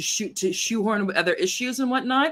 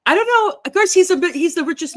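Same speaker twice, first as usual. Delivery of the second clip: incredulous; 10 semitones higher, 7 dB louder than usual. Real, not acted. Incredulous about Musk.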